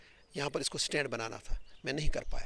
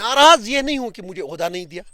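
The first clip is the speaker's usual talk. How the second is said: loud, raised-pitch insistence; emphatic protest; 4 semitones higher, 14 dB louder than usual. Real, not acted. Interrupted politician rebuts the interviewer about his grievance, loudly insistent. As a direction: push the denial out forcefully.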